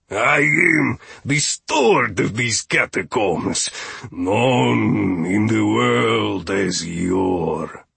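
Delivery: very slow, grandly drawn-out introduction; rumbling steward. A narrator's reading. Steward introduces himself to visitors, slow rumble. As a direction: announce yourself with a stately rumble. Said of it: "His voice rumbled and tumbled".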